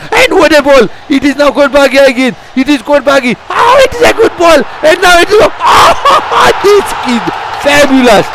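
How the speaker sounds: building to a thrilled peak; excited commentary. Real, not acted. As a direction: commentator swept up in the move. Commentator rising excitement, goal chance.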